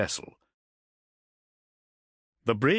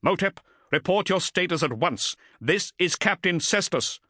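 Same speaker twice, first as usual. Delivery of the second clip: plain reading voice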